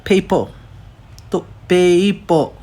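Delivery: पेपर as "pey-ee-po", a glide after pe; added ee glide. Shown as a demonstration. Teacher breaking down the vowel, पेपर as "pey-ee-po". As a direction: stretch पेपर slowly to expose the ee. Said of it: "ई वाला sound आता है".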